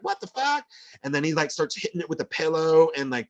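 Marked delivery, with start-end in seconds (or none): 0.0-0.6 pitched way up, startled disbelief; husband startled awake by raccoons, high disbelieving cry; shocked outburst. Retold or imitated. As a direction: jolt awake and blurt it out.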